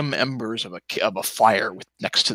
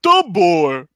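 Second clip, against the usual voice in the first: louder cartoon guard voice; dopey cartoon bellow; 5 semitones higher, 6 dB louder than usual. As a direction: spit out the name dimly. Doorman voice behind door.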